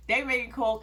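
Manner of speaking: ordinary talk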